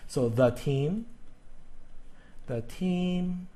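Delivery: slow "the team", with "the" said thuh. Shown as a demonstration. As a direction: say "the" to rhyme with duh before "team". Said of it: "typical pronunciation that we usually use is the".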